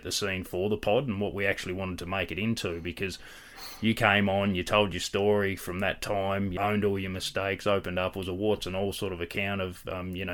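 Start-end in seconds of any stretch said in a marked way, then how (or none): none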